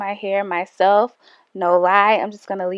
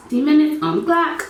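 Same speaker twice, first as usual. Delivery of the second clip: excited call; 6 semitones higher, faster than usual. Real, not acted. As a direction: call out the countdown start. Starting timer, calling out.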